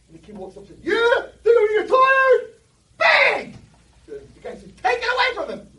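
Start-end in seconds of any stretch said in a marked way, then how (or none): 0.8-3.6 high, loud sobbing yell; crying shout. Retold or imitated. Kid strikes again, crying shout. 4.8-5.7 high, loud demand; frantic yell. Retold or imitated. Neighbor yells again.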